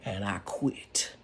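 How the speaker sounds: low unvoiced slow aside; whisper. Real, not acted. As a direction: drop to a slow breathy whisper. Deadpan punchline.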